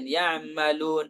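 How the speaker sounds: ya'malun with only half an ain, slow. Shown as a demonstration. Teacher imitating the half-ain error, slow. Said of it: "mengucapkan setengah ain".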